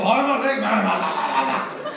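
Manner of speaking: big man bluster. Retold or imitated. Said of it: "First, what you do is you go".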